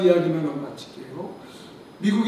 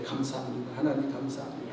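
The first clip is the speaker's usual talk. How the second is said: softer, gentle thanks; quiet demo prayer; 6 semitones lower, 10 dB quieter than usual. Shown as a demonstration. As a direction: show them how to murmur thanks. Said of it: "감사하세요 다 입에". (조용히 읊는 감사 기도)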